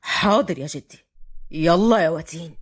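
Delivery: low-pitched male figure complying; young man's voice. A narrator's reading. Fahd obeys and summons Watin, low.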